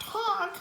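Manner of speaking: high honk sound; honking snort. Acted. Narrator imitating his own snorting laugh, high honk.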